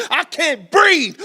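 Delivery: slow, high, full-throated shout; anguished rallying shout. Acted. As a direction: cry it out with everything you have. Poem climax, refrain shouted.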